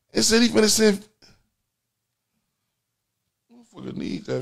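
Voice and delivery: ordinary talk, nothing marked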